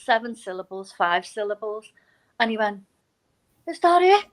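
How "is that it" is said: higher, louder astonished question; incredulous. Retold or imitated. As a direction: imitate someone amazed it's so little. Retold learner's surprise at easy haiku.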